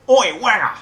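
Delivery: very loud, high Cockney shout, middle consonant nearly gone; Mockney yell, dropped further. Shown as a demonstration. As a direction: holler it in harsh Cockney, swallowing the K. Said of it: "maybe drop it a little more".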